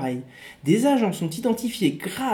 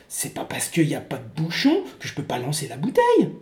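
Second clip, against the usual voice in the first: whispered, lower; whispered aside; 4 semitones lower than usual. Acted. Soldier's aside.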